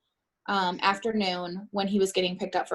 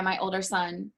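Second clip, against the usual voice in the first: usual speaking voice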